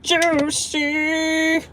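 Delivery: high shout of Juicy. Pretend. Celebrating a tip.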